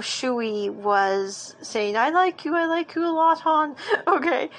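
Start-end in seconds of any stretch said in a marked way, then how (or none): 2.0-3.8 excited fangirl quote. Retold or imitated. Squealing over famous confession. 3.9-4.5 laughing. Real, not acted. Laughs after gushing.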